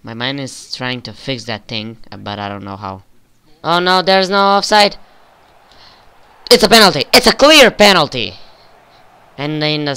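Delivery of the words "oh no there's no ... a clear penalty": loud, high excited call of the penalty; excited shout. Real, not acted. Player calling out a penalty for his team, excited shout. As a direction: shout it out, thrilled.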